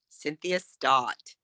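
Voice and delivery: "Cynthia Stott" slow and low, ending down; falling tune. Shown as a demonstration. Coach repeating the confident model name, falling tune. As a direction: settle "Stott" firmly downward. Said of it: "So try to go down".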